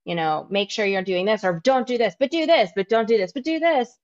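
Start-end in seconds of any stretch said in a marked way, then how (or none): 1.4-4.0 mocking, much higher on do this; sing-song mimic of diet rules. Mocking diet advice list.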